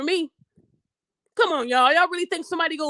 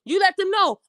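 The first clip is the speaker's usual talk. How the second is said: normal talking voice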